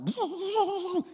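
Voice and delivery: high-pitched babbling noise; high wordless chaos sound; distraction noise. Retold or imitated. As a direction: imitate little kids' distracting racket. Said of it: "your distraction is like".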